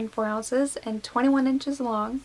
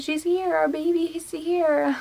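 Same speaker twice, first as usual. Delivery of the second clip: much higher, slow and amazed; overjoyed disbelief; 6 semitones higher, slower than usual. Retold or imitated. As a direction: tell him the baby has finally arrived, in awe. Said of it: "I'm like".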